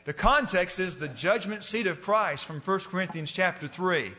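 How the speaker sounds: usual speaking voice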